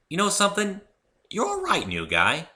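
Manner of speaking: Rainbow Dash voice. A narrator's reading. Rainbow Dash decides the new guy is all right, high and friendly. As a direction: hand him a grudging compliment.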